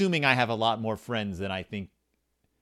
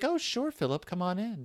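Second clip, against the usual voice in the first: high, soft welcoming mom voice; friend's mom; 7 semitones higher, 3 dB quieter than usual. Retold or imitated. Friend's mom letting the kid in, high and welcoming. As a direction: imitate a mom in a sweetly high voice.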